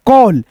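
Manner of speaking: कॉल with a long open aw, never ae; teacher drawing out the aw sound in कॉल; vowel said as aw. Shown as a demonstration. Teacher showing students the aw in कॉल. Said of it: "औ के रूप में उच्चारण करोगे ऐसे".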